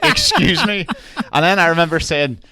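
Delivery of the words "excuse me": high, loud, taken-aback landlord. Retold or imitated. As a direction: imitate a baffled Airbnb host over the phone. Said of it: "he's hearing in his voice".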